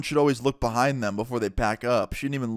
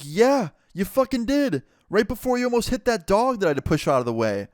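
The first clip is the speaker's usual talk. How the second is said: higher-pitched, exasperated comeback; indignant retort; 8 semitones higher than usual. Retold or imitated. Storyteller confronts driver who hit him, indignant retort. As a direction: fire back indignantly, pitched up.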